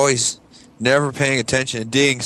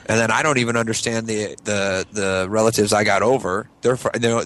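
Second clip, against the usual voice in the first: normal talking voice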